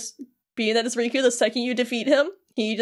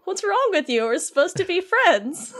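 hurt Sora; 8 semitones higher than usual. Retold or imitated. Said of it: "And he's just like".

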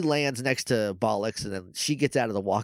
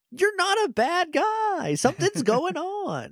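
high, slow, hammed-up earnest voice; mock earnest Mac; slower than usual. Mac reassuring Tyzon he is not bad, in a high slow earnest voice. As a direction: reassure him, overdoing the sincerity. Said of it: "And Mac's like".

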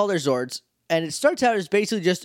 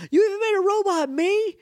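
shocked Justin. Retold or imitated. Justin facing his robot double, high mock shock. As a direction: gasp it in mock horror. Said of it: "another Justin like".

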